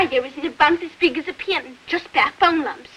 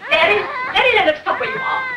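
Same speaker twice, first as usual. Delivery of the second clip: urgent shout; 6 semitones higher, faster than usual. Acted. Calling out in panic.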